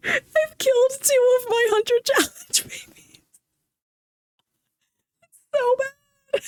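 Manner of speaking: high, drawn-out fake sob; mock wailing. Streamer lamenting lost game babies to viewers, mock wailing. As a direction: wail it slowly in a squeaky, exaggerated cry.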